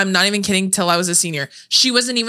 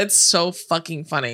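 ordinary talk, nothing marked

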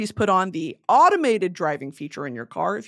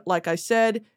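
ordinary talk

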